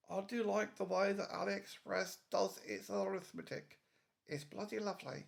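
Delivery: lightly voiced dodgy Vivian accent; a hint of the Young Ones punk sneer. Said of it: "why I'm doing a dodgy accent".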